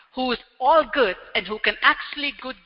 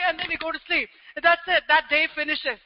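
ordinary talk

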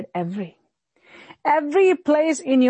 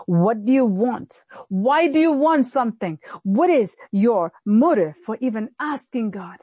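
ordinary talk, nothing marked

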